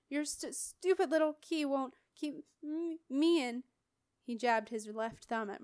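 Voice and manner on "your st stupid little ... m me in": higher, stammering sneer with a streaming cold; stuttering sneering prisoner. Acted. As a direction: jeer through a blocked nose. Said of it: "his cold returned with a vengeance".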